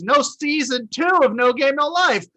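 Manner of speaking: mock outrage. Real, not acted. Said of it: "mock outrage".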